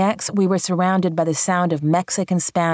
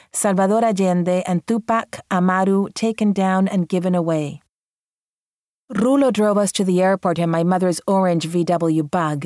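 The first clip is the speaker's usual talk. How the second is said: usual speaking voice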